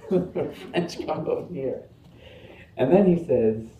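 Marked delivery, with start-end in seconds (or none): 0.1-1.8 higher mock-British summons; British comic host ushering guests; lightly British, amused roll-call; imitated British accent. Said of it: "he has his British accent".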